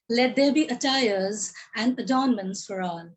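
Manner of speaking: measured poetic reading with long pauses; poem recitation. Acted. A poet reciting her poem to the audience, measured and deliberate. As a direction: recite each line as a refrain.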